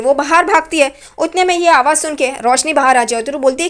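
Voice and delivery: usual speaking voice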